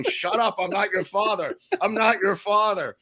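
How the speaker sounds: mock scream, high-pitched, little added volume; Julius Hoffman. Judge screaming back at the heckling defendant, mock yelling. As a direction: imitate an exasperated, high-pitched denial. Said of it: "He's, like, screaming at Abby Hoffman".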